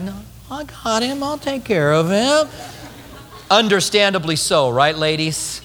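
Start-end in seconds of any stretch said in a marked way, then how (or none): 0.0-2.5 doting woman. Acted. Woman keeping the baby.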